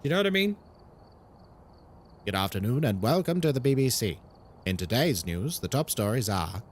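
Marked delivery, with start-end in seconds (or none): 2.2-6.6 low, formal British newsreader voice; BBC newsreader. Acted. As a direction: read it like a BBC news bulletin. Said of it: "it would probably be B B C Newsreader".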